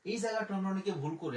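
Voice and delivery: normal talking voice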